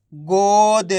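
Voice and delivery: गोद with a long held "o" and light final द; half final letter. Shown as a demonstration. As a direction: draw out गोद for learners, halving the द. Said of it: "last वाले अक्षर का उच्चारण आधा किया जाता है". (गोद को खींचकर, आखिरी द आधा)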